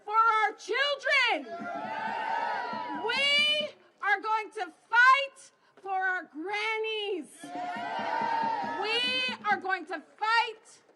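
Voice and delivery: slow, emphatic rally cry; rally speech. Acted. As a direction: hammer each line home.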